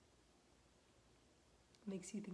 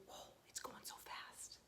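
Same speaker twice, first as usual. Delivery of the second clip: hushed pretend thought; 8 dB quieter than usual. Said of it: "Those lines tell you".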